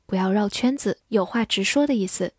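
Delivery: ordinary talk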